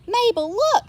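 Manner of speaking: high, loud excited cow; delighted cow pointing out lights; Molly excited. A narrator's reading. Molly the cow showing Mabel the flashing police lights. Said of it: "said Molly".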